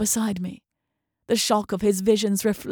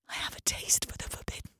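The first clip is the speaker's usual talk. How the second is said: fast, hushed confession; secret whisper; 13 dB quieter, faster than usual. A narrator's reading. Roberta confesses her taste for the forbidden in a fast hushed whisper. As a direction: breathe it like a guilty secret. Narrator confesses dark desire.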